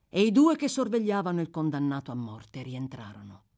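ordinary delivery

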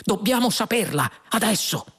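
high, insistent demand; exclaiming. A narrator's reading. Fuog demands the answer from the computer, exclaiming. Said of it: "esclamò Fuog".

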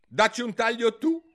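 high, loud, determined retort; very loud, decisive. A narrator's reading. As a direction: shout it back at the queen firmly. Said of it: "disse Alice a voce molto alta e decisa". (voce molto alta e decisa)

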